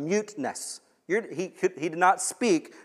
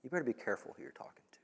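hushed, fast whispered warning; 15 dB quieter, much faster than usual. Pretend. God warning the complainer, fast hushed whisper. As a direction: breathe it out as a quick menacing warning.

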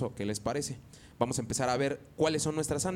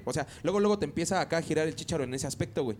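normal talking voice

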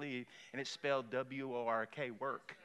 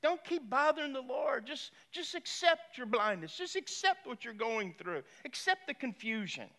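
mock scolding; 9 semitones higher, 5 dB louder than usual. Imitating people who discourage prayer.

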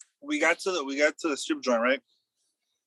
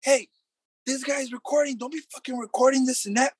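mock stripper yelling; 10 semitones higher than usual. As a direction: imitate her shouting him down, pitched up. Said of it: "the stripper started tripping".

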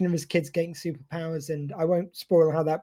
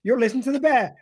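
higher, louder radio jingle; 6 semitones higher, 5 dB louder than usual. Retold or imitated. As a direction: sell it like a station ident. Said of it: "any jingle for the bear".

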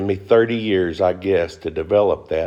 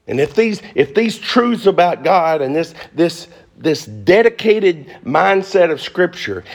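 impassioned appeal; 9 semitones higher, 4 dB louder than usual. Real, not acted. Urgent high appeal with phone number.

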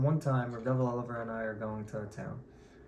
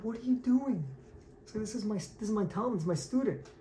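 high-pitched, indignant retold protest; alarmed protest; 8 semitones higher than usual. Rebbe runs over to defend his student, alarmed protest. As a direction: break in on their behalf, voice raised in pitch only.

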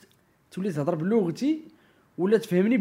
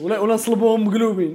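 louder, higher, through laughter; laughing; 6 semitones higher, 10 dB louder than usual. Real, not acted. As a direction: push the joke out while laughing. Joke escalates, both laughing.

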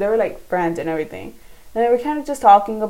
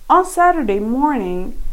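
normal talking voice

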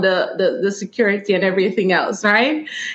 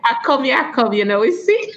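grandma's answer in Jamaican patois; a light Jamaican patois reply; 3 semitones higher, faster than usual. Shown as a demonstration. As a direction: Jamaican patois, higher on see. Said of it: "say what it is now in Jamaican".

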